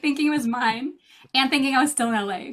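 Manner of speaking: ordinary talk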